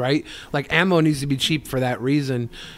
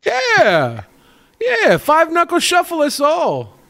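mock hyped cheer; 12 semitones higher, slower than usual.

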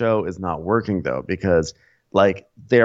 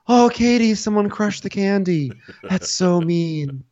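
hammed-up whining about candy; mock whiny Tom; 10 semitones higher than usual. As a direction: whimper like a sulky man-child. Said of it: "stop being mean to the birds".

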